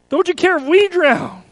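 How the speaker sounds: high, loud pleading cry; desperate plea. Acted. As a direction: press the question with fear. Preacher repeats the plea again.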